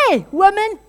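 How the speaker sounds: high, loud barking call at the woman; mock shout. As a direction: bellow at her like an angry priest. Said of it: "shouts at her".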